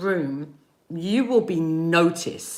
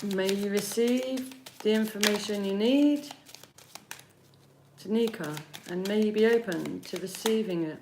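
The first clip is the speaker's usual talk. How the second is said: blessing; 6 dB quieter than usual. Acted. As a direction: bless the reading softly, unhurried. Blessing before reading.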